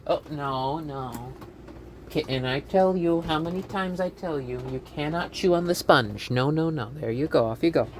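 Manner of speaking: soft, playful scolding in character; put-on character voice. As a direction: chide gently in a small, hushed voice. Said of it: "that voice would tire me".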